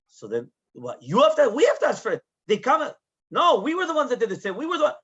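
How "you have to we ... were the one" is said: high, loud retold protest; insistent apologizing. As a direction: argue over who should apologize. Both sides compete to apologise.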